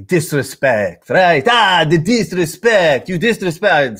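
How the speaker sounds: stretched-out, over-the-top fake Italian accent; bad Italian accent. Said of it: "that's a bad Italian accent".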